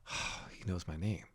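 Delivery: quiet, relieved murmur; softly relieved, almost to yourself; soft relief. Retold or imitated. Relief on hearing his name.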